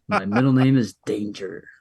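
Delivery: low-pitched cool tough-guy line; selling it. Shown as a demonstration. Showing a friend how to sell a bragging line, selling it. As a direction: sell it low, pausing before the last word. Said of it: "You gotta sell it".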